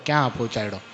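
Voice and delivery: ક્યાં પહોંચાડ્યો dropped low and soft in wonder; awed hush. Shown as a demonstration. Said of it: "વિચાર કરો".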